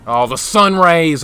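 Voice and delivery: ordinary talk, nothing marked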